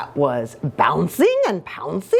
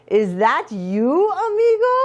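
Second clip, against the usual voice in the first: hopeful recognition; 8 semitones higher than usual. Acted. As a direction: let the pitch soar on the last word. Scapito recognises his friend.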